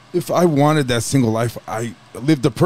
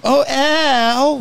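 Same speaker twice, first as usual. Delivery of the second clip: Peg Bundy wail; 11 semitones higher than usual. Retold or imitated. Husband imitating his wife as Peg Bundy. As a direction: whine Oh Al like a sitcom wife. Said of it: "Peg Bundy was in the fucking room".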